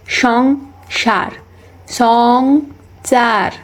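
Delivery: সংসার stretched very slowly, each sound labored; slow effortful সংসার with the স sounds exaggerated; drawn-out careful pronunciation. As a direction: drag out সংসার syllable by syllable, straining to get it right. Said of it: "সংসার উচ্চারণ করতে করতে".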